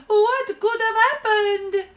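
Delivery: slow, high, loud wail; distraught opera-diva howl. A narrator's reading. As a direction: wail it in melodramatic despair. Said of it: "howls Eva".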